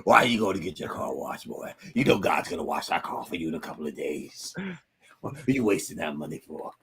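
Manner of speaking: low scolding uncle voice; uncle impression. Uncle telling nephew God will wash the car, low mock scolding. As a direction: imitate an uncle lecturing, pitched well down. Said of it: "that's the way boy sounded when he said it".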